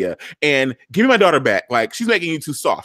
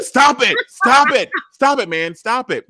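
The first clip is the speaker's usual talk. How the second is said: higher, louder pleas of stop; pleading yell; 11 semitones higher, 3 dB louder than usual. Retold or imitated. Quinn begging Papa Pope to stop, mock yelling. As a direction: beg him to stop, raising the voice. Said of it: "She's like".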